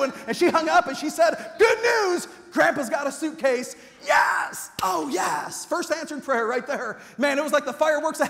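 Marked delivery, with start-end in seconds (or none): 1.6-3.7 high, loud good news; hammed-up glad news from mom; excited announcement. 4.0-5.7 put-on boy cheering the answered prayer.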